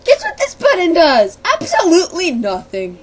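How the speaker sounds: loud mock announcement; playful reveal. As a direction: present it like a big joke. Joking reveal.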